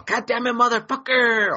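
high, loud pissed-off cursing; mock furious rant. Reenacting yelling at home about some shit, mock furious cursing. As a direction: rant like you're losing it. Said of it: "I was all".

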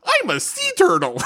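very high, squeaky little voice; high mock child voice; tiny squeaky kid. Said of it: "and then Peyton".

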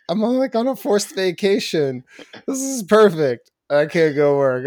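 delighted at the forced break; gleeful. Retold or imitated. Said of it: "I was so excited".

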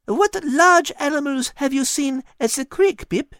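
put-on high, lilting French-accented cat voice; a wise cat coaxing gently; cat Liz. Cat Liz coaxing Patrick in a high French-tinged voice.

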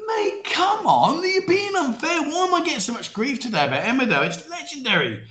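high-pitched, aggrieved pleading; playfully outraged defence; indignant protest. Real, not acted. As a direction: protest as if unfairly picked on, voice way up. Defends Emmerdale against chat teasing.

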